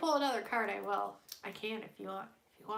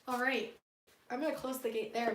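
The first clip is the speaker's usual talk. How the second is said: ordinary talk, nothing marked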